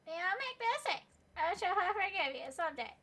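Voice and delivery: high, loud, lofty pronouncement; grand proclamation. Acted. Lofty figure forgiving the player, grand proclamation. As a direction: proclaim it like royalty granting mercy.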